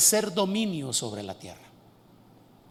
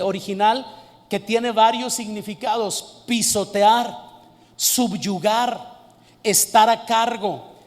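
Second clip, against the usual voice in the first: ordinary talk, nothing marked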